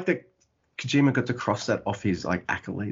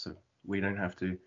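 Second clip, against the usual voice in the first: ordinary talk, nothing marked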